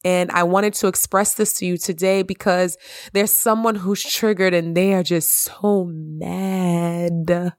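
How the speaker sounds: normal talking voice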